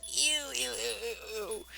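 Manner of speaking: repeated grossed-out ews; disgusted ew. Real, not acted. Player reacts to the wet cough, grossed out. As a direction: recoil from something gross.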